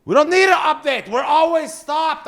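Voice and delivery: high, loud exasperated yell; fed-up driver shouting at the radio; angry shout. Acted.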